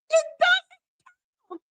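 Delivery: squeaky mocking high pitch. Mocking the judge's ruling in a squeaky high voice. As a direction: mimic the judge in a silly high voice.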